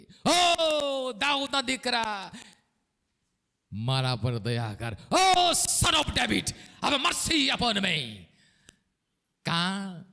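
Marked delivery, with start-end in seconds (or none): 0.0-8.2 slow, pleading cry stretched out; slow drawn-out plea for mercy. Retold or imitated. Blind beggar calling to Jesus, a slow pleading cry. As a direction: draw out each word like a beggar begging. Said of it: "જેટલું જોર હતું એટલું એણે બૂમો પાડી".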